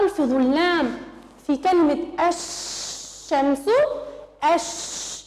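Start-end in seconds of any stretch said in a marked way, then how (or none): 4.5-5.2 الش- whispered, the lam skipped straight to sh; voiceless الش- going from alif to shin; silent lam demo. Shown as a demonstration. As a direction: breathe الش- with no l sound. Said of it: "اللام لم تلفظ".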